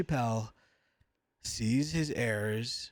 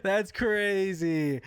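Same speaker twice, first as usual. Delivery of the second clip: stunned disbelief; 5 semitones higher, 4 dB louder, much slower than usual. Real, not acted. Reacting to Chappelle clip.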